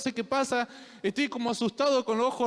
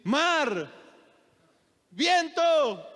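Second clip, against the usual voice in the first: firm command; 4 semitones higher, much slower than usual. Acted. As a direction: order the storm to stop with authority. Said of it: "tuvo que despertarse y decir".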